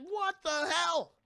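high, slow, stretched what the hell; stunned monster. Retold or imitated. Blinded monster stunned by the flare, slow high disbelief. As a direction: drag it out in shock. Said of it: "He's like".

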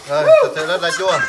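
high, loud cry at the sourness; sour exclamation. Real, not acted. Tasting very sour fruit. (kêu lên vì quá chua)

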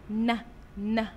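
isolated n sound, said twice; letter sound n. Shown as a demonstration. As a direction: hum ن alone, twice. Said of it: "صوت حرف النون". (نطق صوت ن منفصل)

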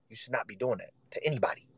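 ordinary talk, nothing marked

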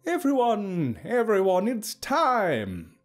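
high, ringing announcement; ringing call. A narrator's reading. Hook summoning the crowd to begin, ringing out. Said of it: "Hook's voice rang out".

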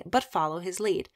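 ordinary delivery, nothing marked

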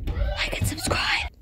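whispering. Real, not acted. Outro line whispered.

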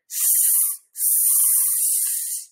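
long, soft sibilant hissing; snake hiss. Acted. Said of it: "the snake keeps going".

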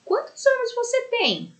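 put-on childlike questioning voice; question tune. Shown as a demonstration. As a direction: ask "Quantos anos você tem?" brightly, like talking to a small child. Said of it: "complete as frases com a pontuação adequada".

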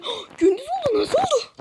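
high, loud amazed outburst; surprised exclaim. Real, not acted. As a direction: burst out in surprise. Sudden daylight surprise. (şaşkın haykırış)

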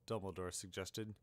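ordinary delivery, nothing marked

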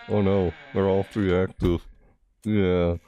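ordinary talk, nothing marked

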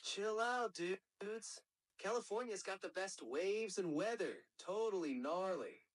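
high-pitched California surfer dude; Californian accent. Shown as a demonstration.

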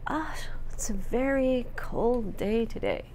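put-on hushed, slow old grandmother voice; lightly voiced grandmother, soft and slow. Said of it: "So your grandmother might say to you".

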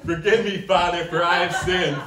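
building from solemn plea into high shout; mock penitent turning to loud shout; mock confession. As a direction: confess to the priest, then burst out. Confessing to priest.

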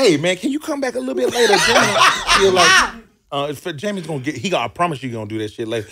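high, fast, laughing put-on voice of the smooth-talking chef; polite excuse. Chef covering for the boss to the hopeful comedian, high laughing mock voice. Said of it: "And he said".

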